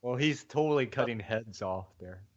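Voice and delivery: ordinary talk, nothing marked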